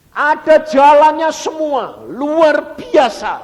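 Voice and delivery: very high, very loud, drawn-out; exultant preaching shout. Real, not acted. Preacher declares everything has a way out, shouting triumphantly. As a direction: shout it slowly, stretching each word to the limit.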